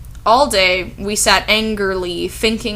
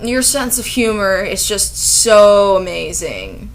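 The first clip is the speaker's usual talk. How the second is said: loud, slow mock praise; deadpan sarcasm for the author; sarcastic; 5 dB louder than usual. Real, not acted. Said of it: "sarcastic praise of author".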